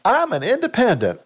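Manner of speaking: lightly put-on, high Collins voice; a weak stab at Sue Collins; bad Sue Collins impression. Imitating Sue Collins at home, lightly put-on. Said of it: "That's a bad Sue Collins accent".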